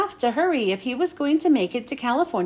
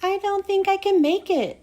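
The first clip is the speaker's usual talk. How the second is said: high whiny tired truck voice; a tired truck whining; 7 semitones higher than usual. Acted. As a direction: whine it out, too sleepy to go on. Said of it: "whined Mac".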